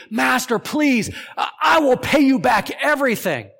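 high, loud begging cry; a frantic debtor shouting for mercy; desperate pleading shout. Acted. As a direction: beg the master at the top of your voice.

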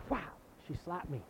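ordinary delivery

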